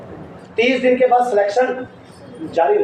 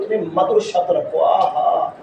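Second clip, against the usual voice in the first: ordinary talk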